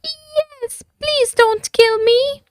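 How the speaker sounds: very high, trembling dragon voice; a terrified little creature begging; quaking. A narrator's reading. The frightened dragon begging the knight, quaking. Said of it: "quaked the dragon".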